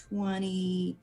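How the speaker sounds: normal talking voice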